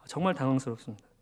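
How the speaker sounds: ordinary talk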